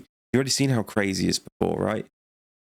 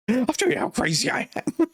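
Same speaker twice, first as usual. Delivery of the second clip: squeaky high manic voice; high-pitched cartoon lunatic threat; unhinged psychopath. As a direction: crack into a mad high squeal. Psychopath's mad last line.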